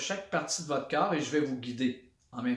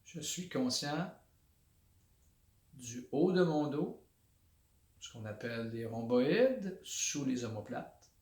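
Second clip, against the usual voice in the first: guided body scan; 3 semitones lower, 6 dB quieter than usual. Shown as a demonstration. Instructor leading listeners through a body awareness exercise, calm meditation voice. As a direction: guide them inward quietly, one body part at a time.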